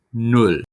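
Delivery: "Null" said clearly with a short oo vowel; teacher's clear "Null"; German model word. Shown as a demonstration. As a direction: model "Null" slowly for learners. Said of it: "it's null. The U is the vowel here".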